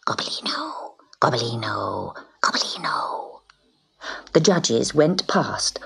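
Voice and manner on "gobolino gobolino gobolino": hissing whisper. Acted. Cats hissing his name.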